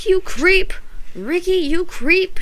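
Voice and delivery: high, loud furious accusation; angry cry. A narrator's reading. Tasha yells at Ricky for erasing page, angry cry. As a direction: yell it at him, outraged. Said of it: "she cried".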